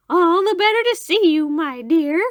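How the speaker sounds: very high, loud put-on granny voice; wolf pretending to be a sweet grandmother. A narrator's reading. Wolf still posing as Grandmother.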